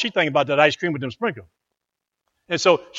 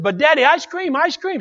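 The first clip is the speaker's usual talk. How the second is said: put-on pleading child; 11 semitones higher than usual. Child begging father for ice cream.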